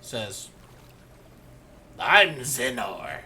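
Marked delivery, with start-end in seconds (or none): 2.0-3.2 loud, slow, drawn-out voice; orc girl. Acted. Female orc child introduces herself, loud and slow. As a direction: announce the name slowly and loudly. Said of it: "the female orc says".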